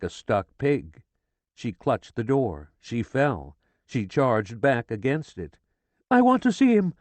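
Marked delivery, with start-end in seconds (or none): 6.1-6.9 high, loud desperate scream. A narrator's reading. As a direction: scream to see her husband. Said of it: "she screamed".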